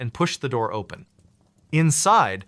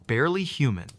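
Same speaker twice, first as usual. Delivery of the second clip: usual speaking voice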